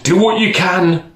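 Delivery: a touch of a weary king; king giving grudging permission. Acted. King voiced in story.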